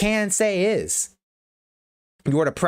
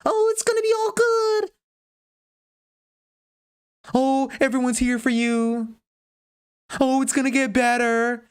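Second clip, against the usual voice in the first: high, sugary mock reassurance, much higher; mocking soft comforting tone; 9 semitones higher than usual. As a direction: parody a syrupy comforter, pitched way up. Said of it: "Talking to you in a soft tone".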